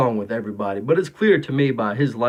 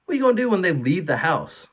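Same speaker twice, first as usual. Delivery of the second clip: ordinary talk, nothing marked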